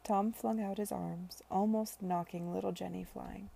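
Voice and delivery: ordinary delivery, nothing marked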